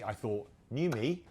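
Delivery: ordinary talk, nothing marked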